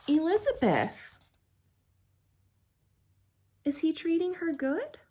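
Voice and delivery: ordinary delivery, nothing marked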